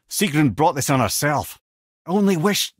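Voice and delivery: ordinary delivery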